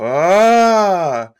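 loud, high crowd ahh; a big awed crowd ahh; audience ah. Acted. The audience roaring ah as the fork rises. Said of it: "they go".

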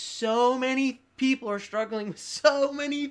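retold heated plea; impassioned pleading. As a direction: argue your case, pitch soaring. Retold pleading argument with God.

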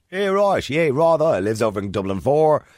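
sending up a posh south Dublin Dublin-four voice; posh southside Dublin accent. Retold or imitated. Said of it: "south side".

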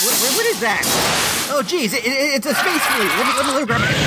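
high frantic alarm at a space flea; panicked space skit voice. Acted. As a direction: yelp in sudden panic.

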